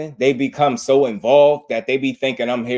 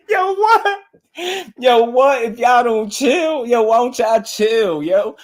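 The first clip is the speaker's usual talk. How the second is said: high, squealing laugh-talk, drawn out; crying with laughter; 9 semitones higher, slower than usual. Retold or imitated. Telling overreacting fans to chill, crying with laughter. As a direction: plead through helpless laughter. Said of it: "I be crying".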